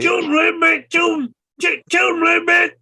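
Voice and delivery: very high, loud panicked shriek; shrieking plea. Pretend. Joking along about the accent, as a shrill panicked shout. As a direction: screech it like a terrified squeal.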